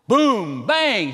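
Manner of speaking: high, very loud storm bangs; thunderclap imitation. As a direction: explode on each word like thunder. Imitates thunder, third time.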